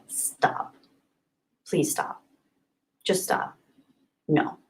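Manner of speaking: soft, nearly whispered begging; quiet, exasperated plea; appalled hushed plea. Real, not acted. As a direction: plead under your breath, worn down.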